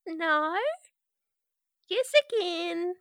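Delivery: put-on creepy little girl=85.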